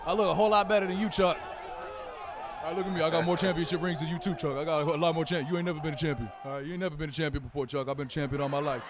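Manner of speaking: hammed-up Shaq trash talk; Shaq impersonation. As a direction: boom like Shaq ribbing Chuck on set. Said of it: "That's my Shaq impersonation".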